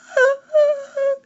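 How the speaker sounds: very high frightened squeak; odd squeaking noises. Acted. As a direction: squeak in panic like a scared boy. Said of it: "began to make odd squeaking noises".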